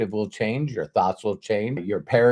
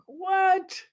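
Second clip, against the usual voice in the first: squeaky high disbelieving what; jolted by the prediction; surprised what. Retold or imitated. Young [name] reacting in disbelief to mentor, surprised. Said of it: "I was going like".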